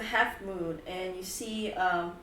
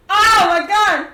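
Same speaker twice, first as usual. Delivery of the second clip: very loud, high, stretched yell; pained scream; 11 semitones higher, 19 dB louder, slower than usual. Acted. Mikkel grabbing the burning whip, screamed in pain. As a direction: scream as your hand gets burned.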